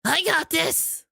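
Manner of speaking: high, slow confident character voice; rogue's voice. Shown as a demonstration. Streamer demos the rogue's voice, confident. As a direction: sound cocky, like the rogue. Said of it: "The rogues is".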